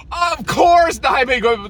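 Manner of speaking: high, loud outburst; sharp shouted exasperation; exasperated shout. Real, not acted. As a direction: burst out in frustration.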